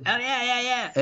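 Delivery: high-pitched eager chorus; mock eager agreement. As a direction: imitate friends jumping at the offer. Friends eagerly agreeing to the party.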